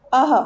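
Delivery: अह ending in a breathy h; visarga sound. Shown as a demonstration. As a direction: say अह with an audible h. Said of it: "ह की तरह होता है".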